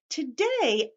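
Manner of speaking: ordinary delivery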